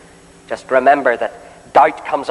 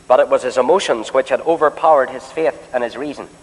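normal talking voice

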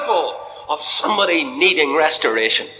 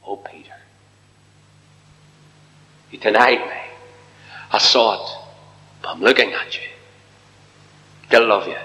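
tender voice of the Lord; 6 semitones lower than usual. Acted. Preacher voices the Lord to Peter.

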